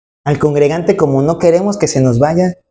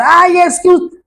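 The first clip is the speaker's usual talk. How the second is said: much higher, hammed-up angry outburst at the pastor; mock scolding yell; 12 semitones higher than usual. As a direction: act out shouting at the pastor, exaggerated. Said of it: "No es que le vas a gritonear". (reclamo gritado y exagerado al pastor)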